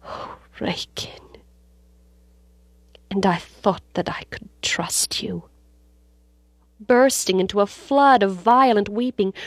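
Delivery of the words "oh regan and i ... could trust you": bitter, heartbroken whisper; hushed, wounded reproach; bitter cry. A narrator's reading. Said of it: "he cried bitterly".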